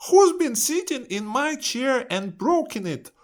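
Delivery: put-on very high baby bear squeak; tiny bear's high-pitched complaint. A narrator's reading. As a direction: squeal it like an upset little bear. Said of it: "says Baby Bear".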